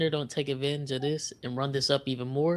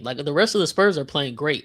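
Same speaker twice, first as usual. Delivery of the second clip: normal talking voice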